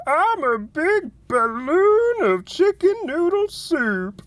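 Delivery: very high, slow, warbling cartoon whine; Homsar impression. Streamer showing chat his Homsar voice, high and wailing. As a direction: stretch it out in a strange high squeaky wail. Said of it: "did a perfect impression of Homsar".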